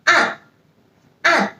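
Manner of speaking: an falling sharply from high; fourth tone an. Shown as a demonstration. Teacher modelling fourth tone an. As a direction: drop an hard from the top. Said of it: "Let's try the last tone".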